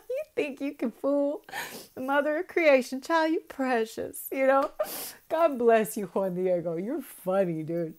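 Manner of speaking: high and loud, laughing while teasing; laughing fondly. Real, not acted. Teasing Juan Diego, laughing high and loud. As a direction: tease him through giggles. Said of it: "I'm, like, crying".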